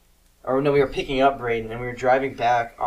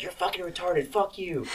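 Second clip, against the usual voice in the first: high, soft mock yell; retold yelling; 6 semitones higher, 6 dB quieter than usual. Older sister turning on sibling, mock yelling. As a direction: imitate a sister yelling, with the volume held down. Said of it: "started yelling at me".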